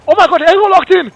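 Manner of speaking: high, loud hype shout; excited yelling. Real, not acted. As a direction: scream it like a huge play. Game-winning lock-in moment.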